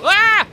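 sudden loud high yelp; startled scream. Real, not acted. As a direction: yell as you get hit. Startled in fight.

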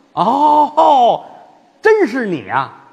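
loud, slow laughing jab; mock surprise. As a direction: feign shock then tease. Feng recognizes him, mocking.

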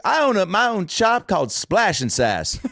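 slow, high mock Houston drawl; thick Houston accent. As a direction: play the Houston shop owner with her accent. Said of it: "someone with a thick accent like that".

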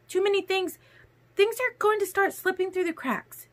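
high-pitched woman's voice, coldly defensive; cold Alice. A narrator's reading. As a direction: list the burdens in a high, cold voice. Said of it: "Alice's voice was cold".

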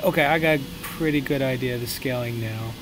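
ordinary talk

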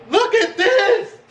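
high, drawn-out excited cry; excited showing off. Real, not acted. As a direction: show it off with a soaring, slow squeal. Showing off his dish, overjoyed.

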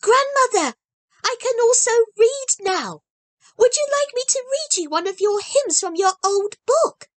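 put-on high, bright child voice, earnest; a small girl promising with full conviction; eager little girl Heidi. A narrator's reading. Heidi offers to read.

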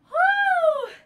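loud high whoop of effort. Real, not acted. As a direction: let out a high cheer. Cheering through the last reps.

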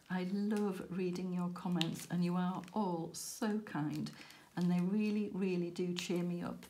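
normal talking voice

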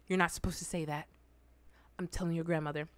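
low, hushed scolding; stern aunt. Retold or imitated. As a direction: imitate an aunt's quiet threat. Imitating the aunt scolding her.

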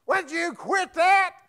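high, loud, slow mock yell at the kids; parent yelling at kids. Mom to kids fighting in back seat, mock yell.

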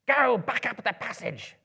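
high, loud goblin shout. A narrator's reading. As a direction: shout the order across the cave. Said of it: "some shouted".

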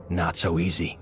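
usual speaking voice

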